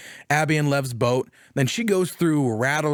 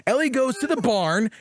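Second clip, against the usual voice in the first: usual speaking voice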